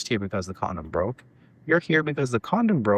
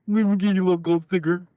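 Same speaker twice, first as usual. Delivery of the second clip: put-on higher, condescending baby-talk; sarcastic mock praise; 7 semitones higher, faster than usual. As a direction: hand out mock praise like to a toddler. Mock reward for the absent father.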